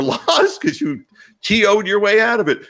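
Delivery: ordinary talk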